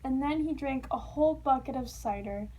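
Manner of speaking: ordinary delivery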